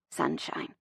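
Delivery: very soft, near-whispered endearment; hushed and fond; warm voice. A narrator's reading. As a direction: say it warmly and tenderly. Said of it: "His voice is warm".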